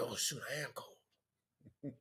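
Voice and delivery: slow, hushed "oh shoot"; player's private doubt, slowed and hushed; quiet worried realization. Retold or imitated. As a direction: drop to a near-murmur as it dawns on you. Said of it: "you start thinking about it like".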